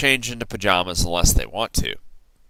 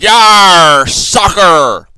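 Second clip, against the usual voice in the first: higher, loud whoop; pirate yell; 9 semitones higher, 13 dB louder, much slower than usual. A narrator's reading. Braidbeard kicking the ball, loud yell. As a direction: yell it with a kick. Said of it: "kicked the ball and yelled".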